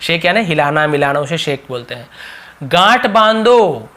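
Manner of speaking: ordinary delivery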